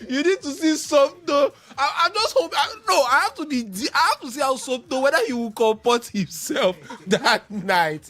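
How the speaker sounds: excited teasing. Real, not acted. Ribbing Somto about watching Arsenal, excited and gleeful. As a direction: egg him on gleefully.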